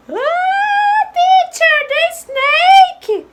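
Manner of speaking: put-on very high, alarmed girl voice; high-pitched pretend panic; frightened little girl. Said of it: "I am afraid of snakes".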